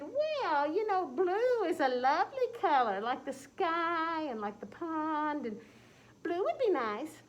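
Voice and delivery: high sweet voice, slow on the sky; cat character voice. Acted. Cat suggesting a new color to Tom, high slow catty voice. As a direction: sell blue like a sly cat.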